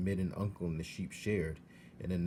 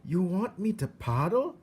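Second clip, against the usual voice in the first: put-on high, rough country girl voice; matter-of-fact young servant girl; pitched-up peasant girl; Mig's voice; 11 semitones higher, 6 dB louder than usual. A narrator's reading. Mig voiced in story.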